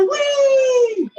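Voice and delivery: high shouted squeal of joy; excited whee. Shown as a demonstration. Storyteller leading the children's cheer, high shouted whee. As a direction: lead the kids in a big cheer. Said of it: "Everyone say".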